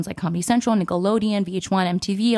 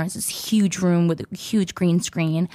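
normal talking voice

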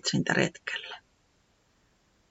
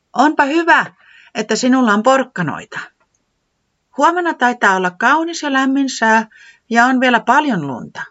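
high, louder little character voice; Tiputi hinting; 9 semitones higher, 5 dB louder than usual. Acted. Tiputi hinting to Säämies about snow, high and eager. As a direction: drop a hint in a bright high voice.

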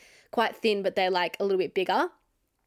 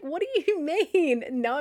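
high, drawn-out mock outrage; indignant protest; 7 semitones higher, slower than usual. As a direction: protest the refusal in a stretched, high whine of disbelief. Retold protest at being refused.

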